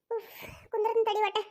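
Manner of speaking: a tired woman slumps down and asks for a moment; exhausted sigh. Acted. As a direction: drag the words out like you can barely sit down. Worn out, asking to sit down.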